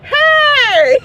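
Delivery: high loud little yell; toddler holler. Retold or imitated. Daughter answering the preacher, high loud yell. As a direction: shout it like a small child. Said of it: "my daughter's like".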